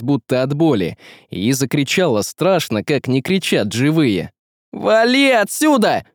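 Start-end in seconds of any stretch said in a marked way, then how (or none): 4.7-6.0 high-pitched angry yell. Acted. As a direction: yell to drive the monster off. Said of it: "заорал Василий".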